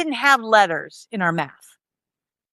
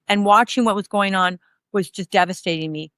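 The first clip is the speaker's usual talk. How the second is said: usual speaking voice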